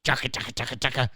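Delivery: low, soft nonsense patter; mock Uber driver patter. Host mimicking an Uber driver's babble, low mock patter. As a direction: rattle off mock foreign gibberish, dropped low. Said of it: "Peter actually became an Uber driver".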